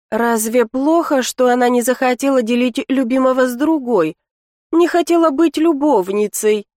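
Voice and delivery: plain reading voice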